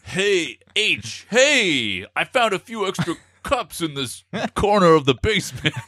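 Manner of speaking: high, hammed-up mover voice, amused; Brooklyn mover. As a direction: call out a find in a put-on voice. Plays mover finding more cups.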